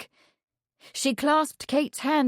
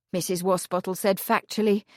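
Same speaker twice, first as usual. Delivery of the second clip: plain reading voice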